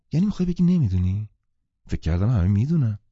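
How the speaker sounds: can't believe he doesn't know; surprised. Acted. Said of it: "در حالی که تعجب کرده بود گفت". (متعجب، زیر و بلند و تند)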